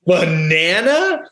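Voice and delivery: imitating his own fury with a laugh; mock outrage. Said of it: "And I'm like".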